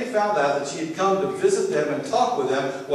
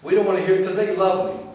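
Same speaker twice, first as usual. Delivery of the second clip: higher, louder, quick protest; retold distressed objection, hurried and raised; upset children; 6 semitones higher, 5 dB louder, faster than usual. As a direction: imitate upset children refusing to listen. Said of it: "My children get upset. They'll cry".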